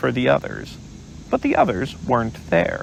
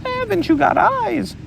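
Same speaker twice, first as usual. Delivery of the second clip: shouting Piglet. Acted. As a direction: shout it high in frustration. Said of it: "shouted Piglet".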